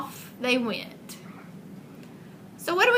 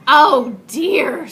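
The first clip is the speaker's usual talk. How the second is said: animals dismayed; 4 semitones higher, 9 dB louder than usual. Acted. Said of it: "said the animals".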